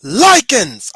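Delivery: high, loud, rallying battle cry; rousing speech. Retold or imitated. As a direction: shout it to an army before the fight. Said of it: "the line at the end of this speech".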